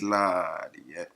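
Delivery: ordinary delivery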